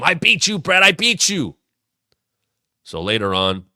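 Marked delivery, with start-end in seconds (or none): 0.0-1.5 fired-up gloat. Retold or imitated. Owen gloating after beating Bret.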